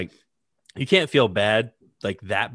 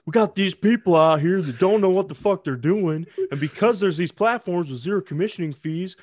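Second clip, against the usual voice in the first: pitched way up, a mock billionaire rant; mocking a rich guy; 9 semitones higher, 4 dB louder than usual. Friend mocks rich people complaining about poor traders, in a high mock voice. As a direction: lampoon the hedge fund guy, high and louder.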